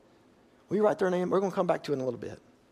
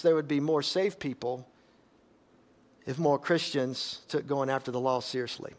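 usual speaking voice

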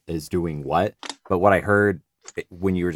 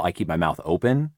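ordinary talk, nothing marked

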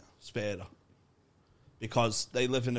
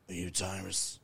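deadpan stoic; 4 semitones lower, 6 dB quieter than usual. Acted. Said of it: "he doesn't have any emotion".

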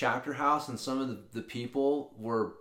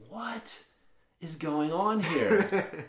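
baffled manager; 6 semitones higher, much slower than usual. Retold or imitated. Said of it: "manager guy is just looking at me like".

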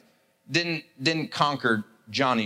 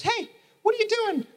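put-on little girl. Little girl interrupting two boys with scooters, high and childlike. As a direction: pipe up like a nosy little girl. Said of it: "some little girl comes around the corner".

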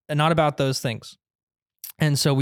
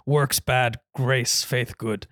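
lightly voiced caveman grunt; blunt caveman talk; slower than usual. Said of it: "that was an amazing caveman impression".

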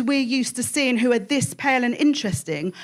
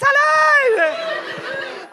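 bright high stage hello; 13 semitones higher than usual. Real, not acted. Comedian greeting the crowd on arrival, excited high hello. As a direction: call hello high with a laugh.

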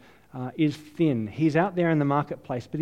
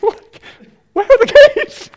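laughing, high shrieked panic over lost keys; panicked shriek; 18 dB louder than usual. Retold or imitated. A preacher reliving losing her keys, panicked shriek. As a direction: squeal it in laughing alarm. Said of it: "I'm like".

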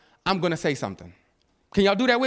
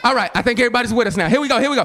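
ordinary talk

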